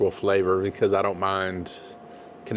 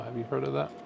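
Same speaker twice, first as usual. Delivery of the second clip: ordinary talk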